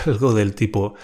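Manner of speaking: ordinary delivery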